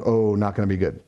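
low, sinking aside to yourself; retold dread. Dad watching his son start freaking out, dreading it. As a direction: drop the pitch in mild foreboding.

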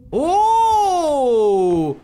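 long high loud "oh", sliding down; startled wail. Real, not acted. Gamer startled by the monster, alarmed wail. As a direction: cry out long and loud, pitch falling. Jump scare in horror game.

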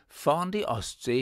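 ordinary talk, nothing marked

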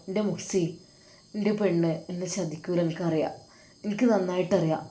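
soft, sorrowful inner plea; tender hush. Acted. Husband softly longing for his missing wife, hushed and sorrowful. Sinan's lonely night thoughts to wife.

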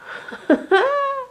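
high burst of real laughter; sudden laugh. Real, not acted. Reader cracks up mid-reading, high giggle. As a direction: break into a giggle.

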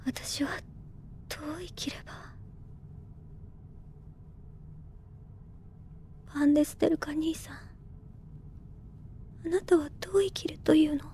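an unvoiced, private whisper; troubled whisper. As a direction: whisper the questions to herself. 独りで思い悩むティア. (兄を想い一人で迷うささやき)

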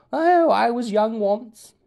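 high, slow, loud wistful teacher. Retold or imitated. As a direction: sigh it like an old teacher. Mimicking teacher sayings.

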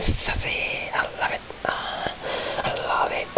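breathy whisper of delight. Pretend. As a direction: whisper it lovingly. Whispered candy love.

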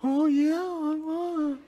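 put-on high little-boy voice faking waking up; feigned sleepiness. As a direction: pretend to be roused from sleep, high and drawn out. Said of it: "I acted like I was asleep".

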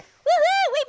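high happy whoop; cheer. Real, not acted. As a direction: celebrate the win. Level cleared.

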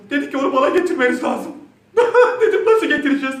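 loud, high, laughing; breaking up with laughter. Real, not acted. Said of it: "yine toparladım". (gülerek, yüksek sesle)

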